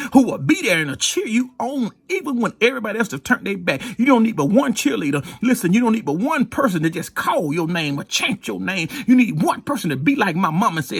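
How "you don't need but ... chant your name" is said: normal talking voice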